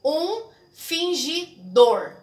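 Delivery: slow, drawing out each syllable of "Um fingidor"; verse scanned aloud syllable by syllable; slow scansion reading. Shown as a demonstration. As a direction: read the verse slowly to show the sound. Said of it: "a gente precisa falar pra ouvir o som".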